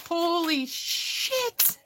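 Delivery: breathy amazed drawl; stunned. Retold or imitated. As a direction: stretch it slowly in shock. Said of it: "I was like".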